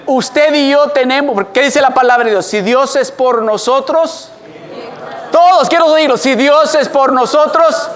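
loud, high preaching, slow on the key verse; preaching at full force. Acted. Preacher proclaiming the verse to the congregation, forceful and loud.

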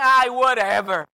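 slow, dismissive drawn-out whatever; lightly mimicked teenage eye-roll; sassy teenager. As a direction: brush the parent off like a bored teen. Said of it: "hijos que les dicen".

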